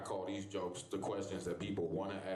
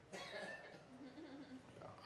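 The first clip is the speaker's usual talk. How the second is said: high laugh; 14 dB quieter than usual. Real, not acted. Laugh after request.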